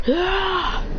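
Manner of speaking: high, sustained "ah!"; play-acted battle cry; effortful attack yell. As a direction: cry out while pouring on power. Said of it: "They go".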